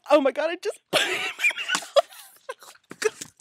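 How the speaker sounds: loud, very high, half-laughing shriek; horrified squeal. Real, not acted. Just put the dirty glove in her mouth, horrified squeal.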